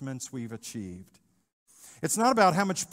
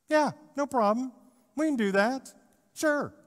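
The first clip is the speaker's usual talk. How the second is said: mock breezy reply; 10 semitones higher, 5 dB louder than usual. Disciples' careless reply, mocked.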